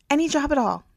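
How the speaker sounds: the words tumble out; blurting. A narrator's reading. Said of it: "blurting out the words".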